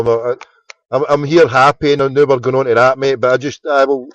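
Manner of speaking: ordinary talk, nothing marked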